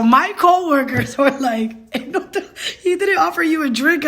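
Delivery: high, breaking into laughter mid-quote; cracking up while imitating coworkers; laughing retelling. Real, not acted. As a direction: barely get the words out for laughing.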